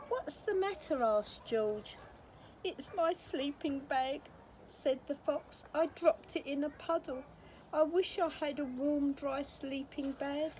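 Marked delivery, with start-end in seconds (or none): 0.1-1.0 a higher, lightly voiced giant; high voiced George. A narrator's reading. George asks fox. 2.6-10.5 crying fox. A narrator's reading. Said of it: "a fox who was crying".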